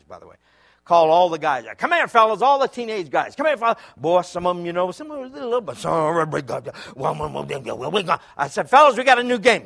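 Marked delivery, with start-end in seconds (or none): 1.8-3.8 calling out. Retold or imitated. 8.7-9.7 calling out. Retold or imitated.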